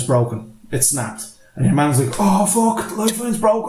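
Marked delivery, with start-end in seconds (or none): 2.2-3.7 higher-pitched retold panic; mock dismay. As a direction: act out a climber's alarmed discovery. Acts climber finding broken lifeline.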